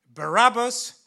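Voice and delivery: a loud call of the name to the crowd; shouting the question. Acted. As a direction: call out Barabbas over the mob. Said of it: "So I shouted".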